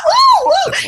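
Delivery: high, squealing mock excitement; mock delighted surprise. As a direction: squeal like opening a present. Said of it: "I was like".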